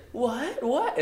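very high, rising squeaky what; mock shocked what. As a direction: play caught-out shock, hammed-up. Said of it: "she's like".

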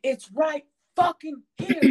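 acting out fed-up impatience; exasperated retort. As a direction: imitate someone losing patience with grandpa. Acted exasperated reply to grandpa.

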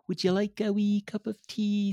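very high, put-on Scottish lady voice; exaggerated high-pitched Scottish hostess; Missus Doubtfire. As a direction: trill it like a dainty Scottish housekeeper. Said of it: "very much Missus Doubtfire-esque".

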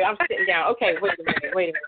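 ordinary talk, nothing marked

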